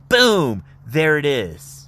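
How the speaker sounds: high, loud triumphant boom; big showy reveal; excited reveal. Real, not acted. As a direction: present it like a magic trick. Unveiling the mountain view.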